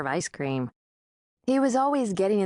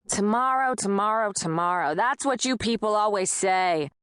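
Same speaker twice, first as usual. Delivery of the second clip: higher Peckerwood landlord, fading tomorrows; scoffing landlord; 3 semitones higher than usual. A narrator's reading. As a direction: sneer at her excuse.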